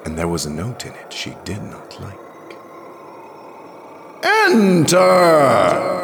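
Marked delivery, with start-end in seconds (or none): 4.2-6.0 one long, high shout; commanding cry. A narrator's reading. The voice orders Jirel into the globe, commanding shout. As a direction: order her in with a ringing cry. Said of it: "cried the voice again, this time a command".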